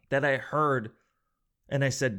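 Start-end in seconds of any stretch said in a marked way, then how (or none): none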